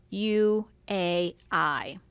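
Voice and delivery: U A I said one by one, slowly; slow letters. Shown as a demonstration. Teacher naming the vowels in Uruguay. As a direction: spell out U A I. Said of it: "So we have".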